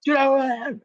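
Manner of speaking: high, shouted mock rage; hammed-up, high-pitched angry rant; confrontational imitation. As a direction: imitate a man yelling right at someone. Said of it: "he's like, right at me".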